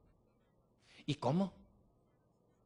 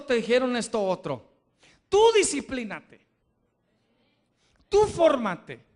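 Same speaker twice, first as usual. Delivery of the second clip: loud, high, driving sermon climax; fiery preaching; 7 semitones higher, 9 dB louder than usual. Acted. Pastor exhorting the congregation, loud and rising. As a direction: preach it hard, rising with each line.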